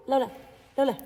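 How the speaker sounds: loud high call to the dog. Real, not acted. Calling the dog over.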